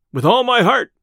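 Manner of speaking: high, loud, hearty exclamation; merry cry. A narrator's reading. Robin takes up the tinker's challenge, merry cry. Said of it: "cried merry Robin".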